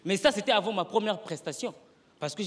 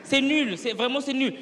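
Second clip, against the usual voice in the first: heckling crowd; 5 semitones higher, 6 dB louder, slower than usual. Retold or imitated. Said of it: "Tout le monde de la salle commençait à dire".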